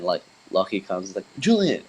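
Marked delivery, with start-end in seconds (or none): 1.4-1.8 calling out. Retold or imitated. Friend calls out his name.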